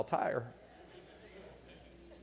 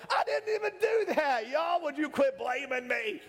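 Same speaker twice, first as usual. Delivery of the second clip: very high-pitched mock protest; hammed-up indignant complaint; exasperated God protesting; 12 semitones higher than usual. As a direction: play a fed-up God, voice shooting up high. Acts God protesting being blamed.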